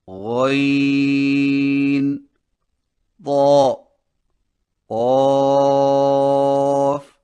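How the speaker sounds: each heavy letter chanted long and full-mouthed; heavy letters drawn out. Shown as a demonstration. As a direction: sing out each heavy Arabic letter slowly. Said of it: "और वो ये हैं". (मोटी आवाज़ में हर हर्फ़ खींचकर)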